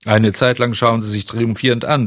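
usual speaking voice

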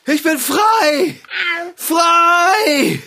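joyful shout. Acted. Freed from the net.

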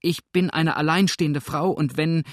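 agitated widow's objection; anxious protest. A narrator's reading. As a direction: plead in alarm until cut off. Widow protests, gets cut off.

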